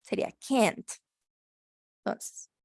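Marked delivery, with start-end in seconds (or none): 0.5-0.9 "can't" with an E-like vowel and a final T. Shown as a demonstration. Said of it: "sería agregar una T".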